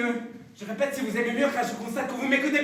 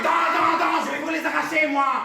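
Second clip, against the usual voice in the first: angry threat; 4 semitones higher than usual. Acted. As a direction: bark the threat. Professor threatens angrily.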